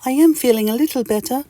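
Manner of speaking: very high, grateful little voice; Mister Itch. Acted. Mister Itch accepts soup when sick, high grateful voice. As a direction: squeak a thank-you. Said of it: "says Mister Itch".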